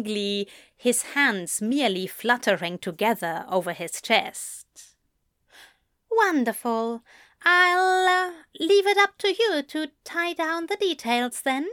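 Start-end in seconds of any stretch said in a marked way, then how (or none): 6.1-11.7 high, hesitant fussy gentleman; a nervous, eager voice lifted high; tentative Alistair. A narrator's reading. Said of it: "Alistair asked tentatively".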